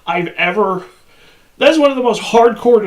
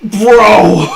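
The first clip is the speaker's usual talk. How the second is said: excited shout. Real, not acted. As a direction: yell it out in delight. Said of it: "I love this guy already".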